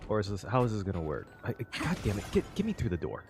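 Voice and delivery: usual speaking voice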